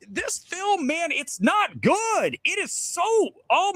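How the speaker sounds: high-pitched, strained groan of disbelief; exasperated. Real, not acted. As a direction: whine it out, fed up with the film. Exasperated rant about the film.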